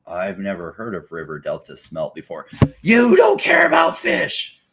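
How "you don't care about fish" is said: shrill high mocking shout of an imagined scold; hammed-up accusing shriek; mock activist shout. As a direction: screech it like a preachy activist.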